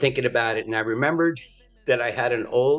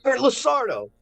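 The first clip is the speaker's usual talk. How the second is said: caller voice; 10 semitones higher than usual. Retold or imitated. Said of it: "He goes".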